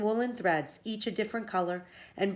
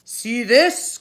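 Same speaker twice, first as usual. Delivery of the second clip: loud, booming giant brag; giant boasting; 5 semitones higher, 11 dB louder than usual. Acted. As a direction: shove it in her face.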